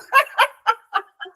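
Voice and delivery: high bursting laugh. Real, not acted. Telling friends about cleaning bathrooms again, real laugh.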